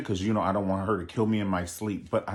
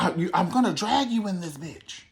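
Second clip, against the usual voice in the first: mock threat; 10 semitones higher than usual. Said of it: "when she's like".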